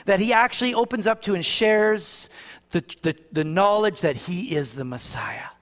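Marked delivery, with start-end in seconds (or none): 4.0-5.5 a soft unvoiced whisper, sharing a secret. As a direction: breathe it like a revelation.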